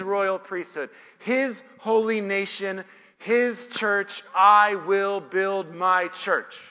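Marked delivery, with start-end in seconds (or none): 4.3-6.6 loud, slow proclamation; emphatic declaration. Real, not acted. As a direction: build to a forceful, drawn-out climax. Climax of a list, verse proclaimed.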